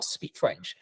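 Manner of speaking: normal talking voice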